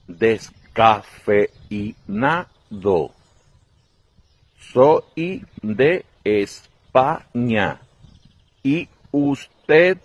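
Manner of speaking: very slow, drawn-out syllables; exaggerated slow speech. Spaniard in a Paris café ordering coffee, very slow. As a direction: stretch every word like talking to foreigners. Customer continues slowly.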